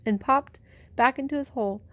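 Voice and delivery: ordinary delivery, nothing marked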